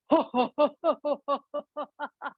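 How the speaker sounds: big belly laugh. Shown as a demonstration. Teacher showing a big belly laugh, ho-ho laughter. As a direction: round ho-ho-ho. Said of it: "a big belly laugh".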